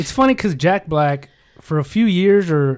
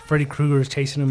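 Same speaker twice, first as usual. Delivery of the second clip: ordinary delivery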